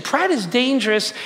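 ordinary talk

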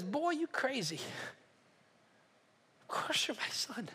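a father's whispered comfort; hushed reassurance. Acted. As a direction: hush him like a forgiving father. Father gently answers repentant son.

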